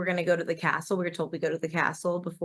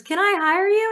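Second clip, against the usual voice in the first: squeaky high imitation of wife; high mocking voice; 13 semitones higher, 5 dB louder than usual. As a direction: pitch it way up like her. Wife asks gondolier, voiced by husband.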